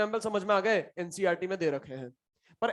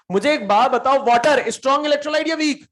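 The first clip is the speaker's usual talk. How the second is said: loud quiz question; 6 semitones higher, 9 dB louder than usual. Real, not acted. Teacher quizzing the class loudly.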